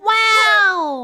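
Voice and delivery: high, loud wow of fake amazement; mock wow. Streamer mock-marvels at the pitch with a loud wow. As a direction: gasp out a hammed-up wow.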